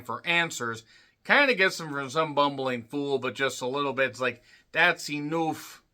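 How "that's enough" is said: imitated Irish accent. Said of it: "I don't know what accent that was".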